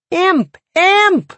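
very high, shrieked alarm; screaming children. A narrator's reading. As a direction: shriek it like frightened little kids. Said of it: "all three children screamed at once".

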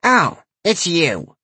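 slow, grumpy granny voice; grumbling old lady. A narrator's reading. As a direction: grumble it, disappointed. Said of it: "she grumbled".

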